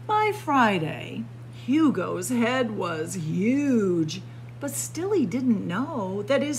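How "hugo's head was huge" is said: ordinary delivery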